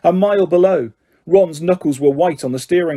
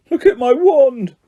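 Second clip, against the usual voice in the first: shaky voice; 8 semitones higher, 3 dB louder than usual. A narrator's reading. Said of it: "said Ron in a shaky voice".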